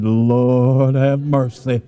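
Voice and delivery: long drawn-out plea to heaven; mock dread. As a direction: drag out the words in slow, comic dismay. Dreading blood test from shaky doctor.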